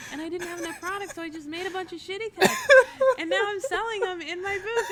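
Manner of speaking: high-pitched giddy fan imitation; retold breathless enthusiasm, giggle creeping in; excited confession. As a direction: gush it out, close to laughing. Imitates excited fan, near laughter.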